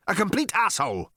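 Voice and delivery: high, emphatic alien put-down; emphatic insult. A narrator's reading. Alien finishing its insult of Arthur, emphatic. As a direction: rub the insult in.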